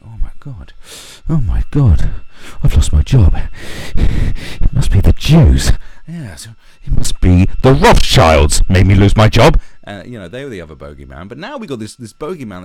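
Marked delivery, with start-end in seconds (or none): 0.0-9.6 loud hammed-up lunatic voice; conspiracy lunatic. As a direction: rant like a crazed conspiracy nut. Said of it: "the other lunatics going".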